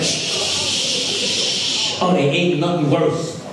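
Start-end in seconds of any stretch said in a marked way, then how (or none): 0.0-2.0 long hissing "shh"; drawn-out spray hiss; imitated spray noise. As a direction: mimic an aerosol spray going off. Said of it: "then they're like".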